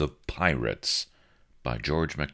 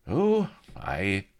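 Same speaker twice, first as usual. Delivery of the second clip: higher offhand aye; careless; 7 semitones higher than usual. A narrator's reading. Blood answers Avery carelessly, higher. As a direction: toss it off carelessly. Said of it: "said Blood carelessly".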